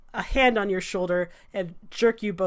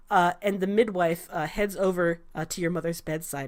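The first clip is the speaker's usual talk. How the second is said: usual speaking voice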